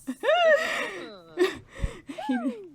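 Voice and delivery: long, high, loud laugh. Real, not acted.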